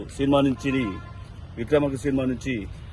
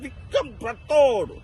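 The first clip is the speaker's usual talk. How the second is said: high, slow, menacing film villain; Babuji voice; 8 semitones higher, slower than usual. Retold or imitated. Mimicry artist on stage doing the Vikramarkudu villain, high and slow. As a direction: growl out the villain's threats in a high strained voice. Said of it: "Babuji voice".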